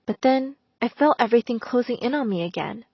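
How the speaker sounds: ordinary delivery, nothing marked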